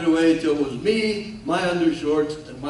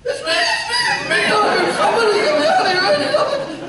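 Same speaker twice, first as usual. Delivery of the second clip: very high, loud frantic radio call; hammed-up shrieking pilot on the radio; panicked high voice; 6 dB louder, slower than usual. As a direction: squeal the mayday three octaves up, drawn out at first. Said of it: "my voice was up about three octaves".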